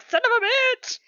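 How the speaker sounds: mock frustration. Retold annoyance at the website.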